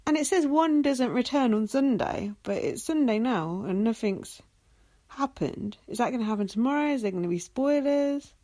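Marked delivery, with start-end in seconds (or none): none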